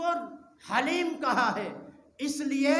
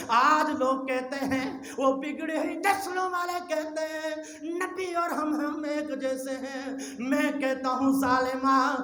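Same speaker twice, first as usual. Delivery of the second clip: loud, chanted rebuke; fiery preaching; 4 semitones higher, 9 dB louder than usual. Acted. Preacher rebukes those who equate themselves with prophets, loud chant. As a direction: scold the crowd in a half-sung sermon.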